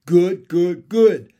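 loud, punchy repeated chorus; froglets echoing. Acted. Froglets echo their father, loud eager chorus. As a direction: bounce out each word loudly.

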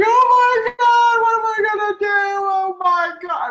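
high, loud, drawn-out mock wail; hammed-up freak-out. Speaker re-enacts her meltdown over being dumped, freaking out. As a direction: wail it in exaggerated panic. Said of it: "I was freaking out".

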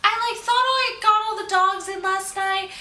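high, put-on voice of a rambling customer; mock customer voice. Cashier imitating the dog-owning customer, high put-on voice. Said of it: "she's like".

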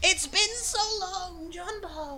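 very high, slow squeal; excited blue goblin. Acted. Blue goblin hugs a long-lost friend, high drawn-out squeal. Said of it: "it's just a blue goblin".

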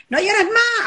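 put-on high, sharp telling-off of a crying boy; scolding parent. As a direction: snap at the child like a stern parent. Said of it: "a los varones".

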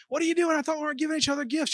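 high, fast startled protest; mock surprise. Husband stunned his wife brought gifts, high and fast. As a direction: act caught off guard by a gift. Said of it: "I'm like".

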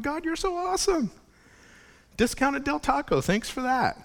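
high, bright burst of grateful joy; delighted thanks. Retold or imitated. Pastor retelling thanking God for a discount, delighted. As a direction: gush thanks to God like a kid. Retold praise after Del Taco discount.

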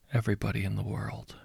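hushed whisper of a dark request. Acted. Caller asks to kill everyone.

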